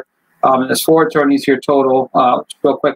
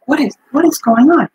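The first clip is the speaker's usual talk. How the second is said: much higher, squeaky confused voice; baffled new inmate; 11 semitones higher than usual. Retold or imitated. New prisoner baffled by the laughing crowd, squeaky high. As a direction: play the lost new guy, pitch way up. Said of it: "He's like".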